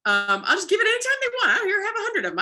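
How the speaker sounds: playfully showering the dog with cookies; playful giving. Acted. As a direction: toss the treats out like it's nothing. Playfully offering treats to dog.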